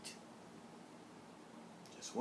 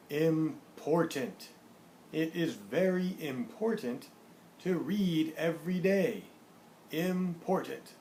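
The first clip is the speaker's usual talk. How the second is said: put-on slow, deliberate model reading for young learners; measured teaching read-aloud with drawn-out key words; word-card reading; slower than usual. Shown as a demonstration. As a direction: announce each word and sentence distinctly, unhurried. Reads word cards with example sentences.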